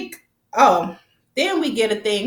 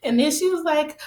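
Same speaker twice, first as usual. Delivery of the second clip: ordinary talk, nothing marked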